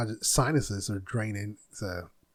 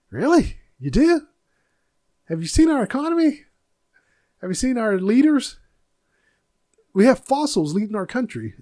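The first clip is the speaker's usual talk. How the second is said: incredulous and much higher, louder; mock disbelief; 8 semitones higher, 4 dB louder than usual. Mocking America's fans with high incredulous disbelief. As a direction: scoff at them in a high voice of disbelief.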